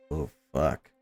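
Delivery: normal talking voice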